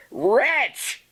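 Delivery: a sharp high cry; cried out. Acted. Narrator crying out at the raven, high. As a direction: hurl the word at the bird. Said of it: "I cried".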